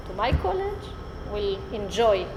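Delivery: ordinary talk, nothing marked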